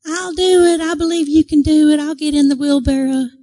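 high, loud little-man voice; imitated eager little old volunteer; shriveled little man. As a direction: pipe up from the back row. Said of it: "shriveled up little man goes".

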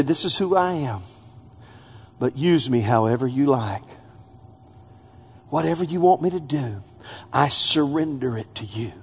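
quiet, low and tender; hushed prayer of surrender; soft surrender prayer. Acted. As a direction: pray it gently, handing it over.

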